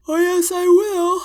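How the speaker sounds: loud, slow, stretched yawn. Acted. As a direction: say it through a big yawn. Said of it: "Lexi says through another yawn".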